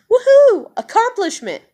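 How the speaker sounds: high, loud mock celebration cheer; a pretend woohoo for herself. Imagining cheering her own job milestone, loud and high. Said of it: "be proud of myself".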